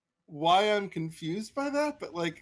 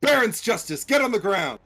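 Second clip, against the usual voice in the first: shouted command; 5 semitones higher, 7 dB louder than usual. Shown as a demonstration. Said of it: "can both of you say".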